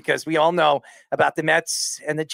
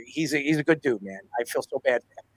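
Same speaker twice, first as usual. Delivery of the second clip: ordinary talk, nothing marked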